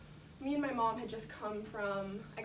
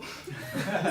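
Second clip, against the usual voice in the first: breathy laugh; 3 semitones lower, 5 dB louder than usual. Real, not acted. Laughs at the shove.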